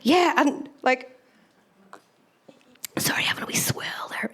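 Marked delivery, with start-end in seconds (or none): 3.0-4.3 a breathy, voiceless apology; whispered aside. Real, not acted. Apologising to the crowd mid-sip, whispered. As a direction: breathe it out under your breath. Aside while sipping drink.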